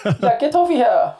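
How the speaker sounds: put-on high, loud Scandinavian accent, laughing. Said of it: "do it in a Scandinavian accent now".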